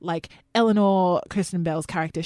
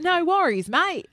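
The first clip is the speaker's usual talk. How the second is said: higher, hammed-up Australian drawl on "No worries, mate"; Australian accent; 11 semitones higher than usual. Shown as a demonstration. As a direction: lay on a mock Australian accent, pitched up. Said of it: "so it's like that".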